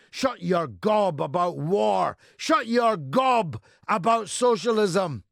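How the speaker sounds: high, loud, escalating quoted orders; retold shouting. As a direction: build to a full bellow, order by order. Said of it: "They're shouting at Jeremy Corbyn".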